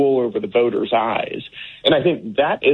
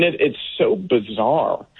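ordinary talk

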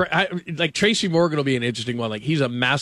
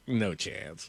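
imitated dismissal; 6 semitones lower, 11 dB quieter than usual. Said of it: "he just looked at me like".

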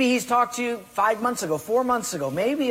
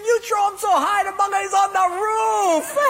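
high, loud mocking shout; Portuguese guy laughing at his friend, high loud mockery; laughing taunt; 13 semitones higher, 4 dB louder than usual. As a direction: crow at him between laughs. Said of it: "the Portuguese guy's laughing".

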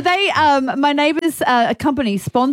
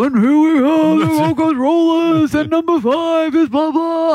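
stadium announcer; 5 semitones higher, slower than usual. Retold or imitated. MC introducing the Gold Coast Rollers, in a hyped announcer voice. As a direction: boom it out like the court MC. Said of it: "there's the, the guy".

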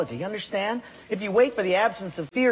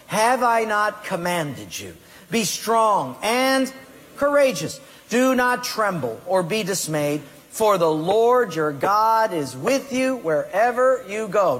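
rising, emphatic scripture reading with a slowed, stretched "courageous"; scripture read aloud; 3 semitones higher, 4 dB louder than usual. Acted. Preacher leads the congregation through Joshua one nine, reading emphatically. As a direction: read it like a command from God. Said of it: "Read it with me".

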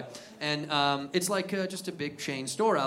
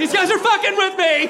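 very high, loud mock outrage; high-pitched aggrieved yell; loud tattling; 8 dB louder than usual. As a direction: shout it to your rescuer. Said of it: "I'm like".

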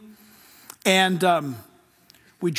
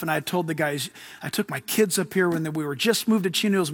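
ordinary talk

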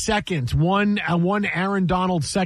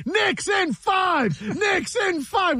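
high, drawn-out mock yell; high-pitched re-enacted boat chant; retold yelling; 13 semitones higher, much slower than usual. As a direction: imitate chanting across the water without full volume. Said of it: "and I'm yelling".

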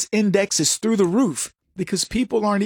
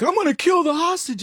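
higher mock threat from the hostage taker; a hammed-up bad guy threatening; mock villain ultimatum; 10 semitones higher than usual. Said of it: "he's like".

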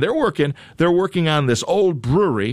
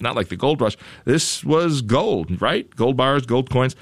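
normal talking voice

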